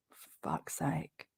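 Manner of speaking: soft whispered curse; whispered exasperation. Real, not acted. Exasperated at Katie's letter, whispered curse. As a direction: breathe it out fed up.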